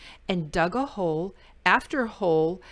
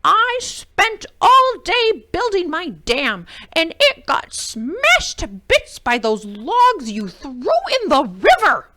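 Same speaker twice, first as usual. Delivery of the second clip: much higher, loud angry creature voice; snarling beaver; 8 semitones higher, 10 dB louder than usual. A narrator's reading. Angry beaver scolding Jim at his door, high loud snarl. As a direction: snarl it out, outraged. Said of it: "he snarled".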